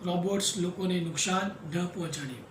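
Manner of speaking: ordinary talk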